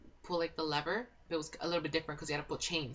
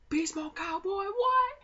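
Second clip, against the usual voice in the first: very high, loud mock outburst; hammed-up furious squeal; angry disbelief; 7 dB louder, slower than usual. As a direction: shriek it outraged. Caleb angry, retold.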